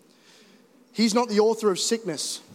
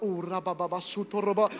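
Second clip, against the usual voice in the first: mock tongues; 3 semitones lower, 5 dB quieter than usual. Mum praying in tongues.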